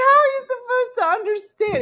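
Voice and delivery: high, loud, half-laughing protest of disbelief; exasperated cry. Retold or imitated. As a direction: wail it like it's an outrage you can't believe. Retold outrage at six-act opera.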